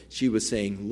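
ordinary talk

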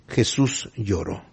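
dilo bajito y despacio, conmovido; lento y suave. Acted. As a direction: casi en un susurro, con pausa. Momento emotivo de la lectura. (pastor leyendo con reverencia, suave y lento)

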